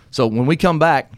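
booming radio sign-off voice; radio host. Acted. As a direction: announce the break like a DJ. Host goes to commercial break.

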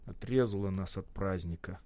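low, soft, sullen; young worker sulking over the lost holiday; gloomy. A narrator's reading. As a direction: mutter it dejectedly. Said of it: "угрюмо проговорил Сашок".

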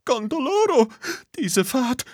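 very high, theatrical wailing lady's voice; a fussy teacher moaning over her nerves; music teacher wailing from the bushes after her crash; lamenting music teacher. A narrator's reading. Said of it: "leises Gejammer".

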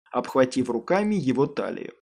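usual speaking voice

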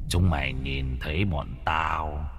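low, slow and menacing; demon voice. A narrator's reading. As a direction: growl it slowly like a demon. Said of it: "Con quỷ đầu đàn lên tiếng".

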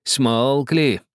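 a touch of gloating. A narrator's reading. Old man gloating at the silent captain. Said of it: "злорадно спросил старик".

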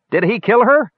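very high, piercing question; shrill voice. A narrator's reading. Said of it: "a shrill voice asked".

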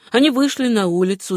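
ordinary delivery

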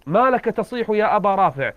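storyteller lightly voicing a would-be rescuer; acted questioning. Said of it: "فقلت".

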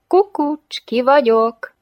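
high, loud, teasing call; playful peekaboo. Acted. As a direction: pipe up as if playing guess-who. Playfully covers his eyes, peekaboo. (játékos, magas hangú kukucskálás)

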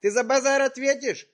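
loud, high, furious threats; angry yelling. Acted. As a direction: yell it at her, furious. Prankster yells at old woman.

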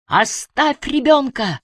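high, drawn-out cry; shouted order. A narrator's reading. Emily sees the child in danger, high shouted plea. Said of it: "закричала Эмили".

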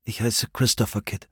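quiet, shy introduction; said softly. A narrator's reading. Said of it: "sagte er leise".

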